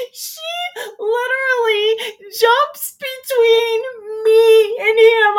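high, loud, drawn-out amazement; worked-up storyteller at the climax; incredulous outburst. Real, not acted. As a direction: stretch it out high and loud, nearly laughing. Incredulous, laughing climax of her story.

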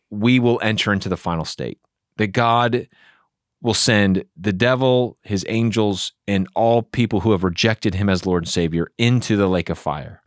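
ordinary delivery

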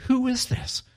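hushed, high-pitched mock astonishment; shocked disciples. Disciples asking who commands wind and waves, retold shock. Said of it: "the disciples were shocked and said".

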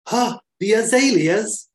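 high, loud baffled Welsh voice; a confused Welsh scientist asking; Welsh aide. Retold or imitated. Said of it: "And he's like".

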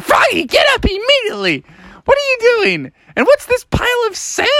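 high-pitched, indignant mother voice; scolding mom. Acted. Mom ordering Froggy off the floor, high and scolding.

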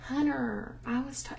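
soft Southern mimicry, T dropped; imitated Southern accent. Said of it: "doesn't say the T in the words".

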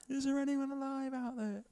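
a hint of a distant call; calling out from a boat. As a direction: call out across dark water. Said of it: "like that boat in Titanic".

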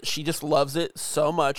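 ordinary talk

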